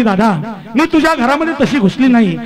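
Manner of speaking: high, ringing voice building in force; preacher voicing the girl's fervent reply; impassioned declaration. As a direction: declare it with rising conviction to the crowd. Acts the girl's proud answer.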